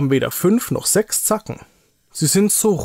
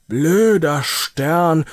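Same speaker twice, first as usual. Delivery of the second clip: drawn-out, cross grumble, pushed louder; grumpy scolding; 3 semitones higher, 4 dB louder, much slower than usual. Acted. Niki scolding his broken clay star, grumpy and cross. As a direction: curse it slowly and crossly. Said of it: "schimpft Niki, ganz grantig ist er".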